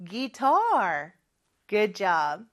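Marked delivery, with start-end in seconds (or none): 0.1-1.1 "guitar" with a long, stressed second syllable; drawn-out model of "guitar", gui-TAR. Shown as a demonstration. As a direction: lean hard on the "tar" of "guitar". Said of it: "이음절에 강세가 있죠".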